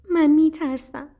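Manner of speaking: high, small scared voice; frightened little sparrow. A narrator's reading. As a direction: confess fear in a high little voice. Trembling sparrow admits fear. (صدای زیر و ترسیدهٔ گنجشک)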